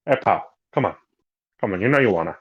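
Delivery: lightly voiced hardboiled detective; a hint of a tough forties private eye; gumshoe voice. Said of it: "he might do it in the gumshoe voice".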